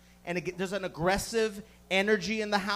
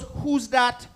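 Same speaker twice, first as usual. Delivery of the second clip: sassy Jamaican nurse; 3 semitones higher than usual. Retold or imitated. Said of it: "A fucking sassy Jamaican nurse".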